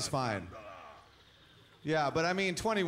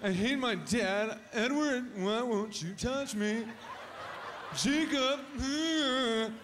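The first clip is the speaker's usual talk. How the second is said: high, slow mocking girly whine; pining fan girl, hammed up; mock teen girl; 6 semitones higher, much slower than usual. Comic mocking a Twilight fan girl, high girlish whine.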